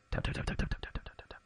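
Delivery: soft, whispered tap tap for little cat paws; soft tapping noises. Retold or imitated. Parent imitating her child's little paw sound effects, whispered and soft. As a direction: tap it out softly like padding kitty feet. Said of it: "she'll just go, like".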